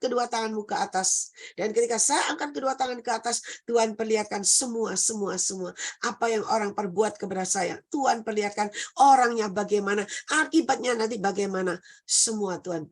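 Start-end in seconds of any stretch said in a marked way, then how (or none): none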